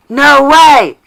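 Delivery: very loud, high cry of disbelief; big mock-shocked exclamation; astonished shout. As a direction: shout it out in hammed-up amazement. Feigned shock at finding a cigarette.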